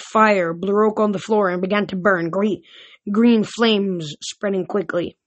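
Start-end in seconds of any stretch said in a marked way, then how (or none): none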